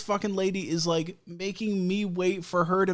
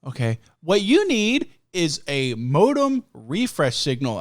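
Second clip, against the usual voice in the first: drawn-out automated phone voice; slow, over-enunciated phone robot; little robot. Retold or imitated. Automated helper instructs a caller, little robot voice. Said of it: "the little robot goes like".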